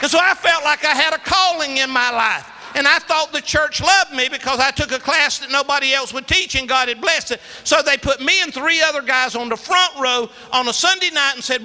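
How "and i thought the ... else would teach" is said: ordinary delivery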